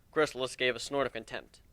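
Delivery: plain reading voice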